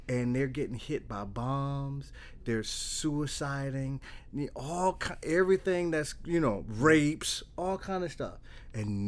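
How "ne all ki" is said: ordinary delivery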